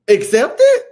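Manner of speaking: loud, high, incredulous question; indignant retort. Acted. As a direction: fire back as if it's obvious. Indignant retort to gift reaction.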